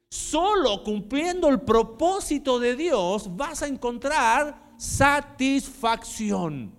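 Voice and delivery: loud, high, impassioned sermon voice; loud preaching with rising passion; fiery preaching. Acted. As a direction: preach it full force to the congregation. Preaches at full force.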